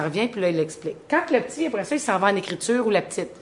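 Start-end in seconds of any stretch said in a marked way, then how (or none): none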